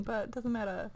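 normal talking voice

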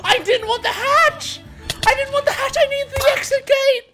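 high, climbing wail of protest; anguished protest. Real, not acted. Gamer forced toward the unwanted hatch, anguished wailing.

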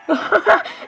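high-pitched burst of laughter; short, high laugh. Real, not acted. Amused reaction to what they saw.

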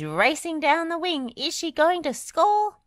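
mock football commentary, high and rising; excited cry. A narrator's reading. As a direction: build it up like a commentator. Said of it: "she cried as she chased".

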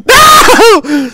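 long, loud scream of "no"; high-pitched howl of protest; agonised scream. Acted. Toe is being chopped off.

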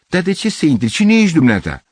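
loud, high-pitched demand; suspicious challenge. Acted. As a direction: challenge a stranger at the door. Suspicious neighbour challenges stranger.

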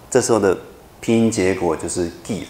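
ordinary talk, nothing marked

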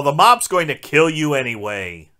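usual speaking voice

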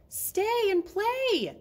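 high, drawn-out playful call; loud sing-out invitation, stretched long. A narrator's reading. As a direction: holler slowly to someone down the road. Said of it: "Snowman called out".

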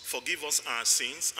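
ordinary delivery

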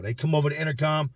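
usual speaking voice

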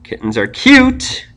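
slow build to a high, drawn-out "cute"; stress on cute. Shown as a demonstration. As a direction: hit "cute" high and hold it. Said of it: "move the emphasis over where it was".

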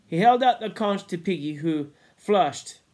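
ordinary delivery, nothing marked

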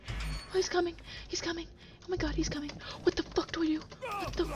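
high, soft panicked voice; being chased. Real, not acted. Monster approaching, hushed panic.

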